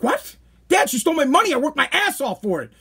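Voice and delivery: high-pitched retold complaint to dad; indignant protest. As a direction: plead the case in disbelief. Retold protest to his father.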